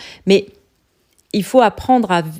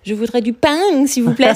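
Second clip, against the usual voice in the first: French with a put-on English accent, pitched up; 4 semitones higher than usual. Shown as a demonstration. Coach demos asking for bread in French, English accent. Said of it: "avec un accent anglais".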